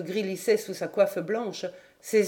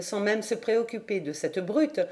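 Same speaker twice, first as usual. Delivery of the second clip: usual speaking voice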